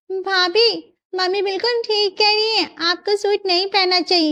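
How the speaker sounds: high-pitched, prim correction; lightly voiced younger sister-in-law; disapproving sister-in-law. Sister-in-law backs her mother.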